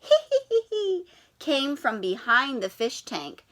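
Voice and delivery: ordinary talk, nothing marked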